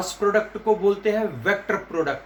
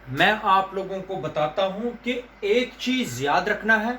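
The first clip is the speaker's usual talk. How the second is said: usual speaking voice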